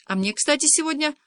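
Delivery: mild narrated whine; whining. A narrator's reading. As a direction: complain with a light moan. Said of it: "заныл Кирюшка".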